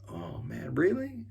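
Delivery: sheepish, caught-off-guard oh man; surprised. Retold or imitated. Caught being harsh, surprised.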